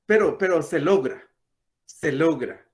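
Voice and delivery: normal talking voice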